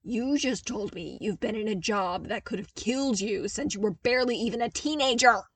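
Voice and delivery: loud, fast heated argument; argued hotly. Acted. Zev arguing hotly with Luke about the danger. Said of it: "Zev argued hotly".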